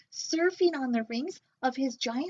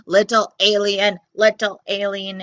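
robot squeak; 2 semitones lower, 9 dB louder than usual. Acted. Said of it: "it squeaked".

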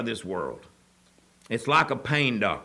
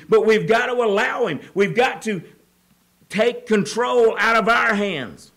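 raised, loud preaching voice, driving hard; fiery preaching; 8 semitones higher, 6 dB louder than usual. Acted. Preacher rousing the congregation about addiction, loud and fervent. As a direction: preach it out to the whole church. Preacher peaks again on God.

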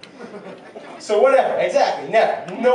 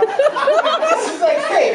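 disbelief; 3 dB louder, much slower than usual. Retold or imitated. Disbelief at the win.

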